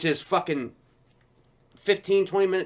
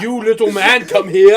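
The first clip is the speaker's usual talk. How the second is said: louder mock Arnold summons; 3 semitones higher, 9 dB louder than usual. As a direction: call him over like Arnold. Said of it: "I'd be like".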